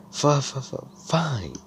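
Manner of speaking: high, stuttering mock fluster; flustered stammer. Flustered girl reluctantly accepting a rival's challenge, high stammer. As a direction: trip over the first sound before giving in.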